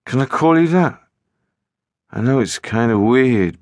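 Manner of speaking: a hint of the boy trying a pet name; boy's voice. A narrator's reading. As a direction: narrator voicing the boy asking to call her Tomboy. Boy voice on the phone.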